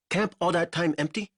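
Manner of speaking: a guide asking a worried question; lightly voiced Ko. A narrator's reading.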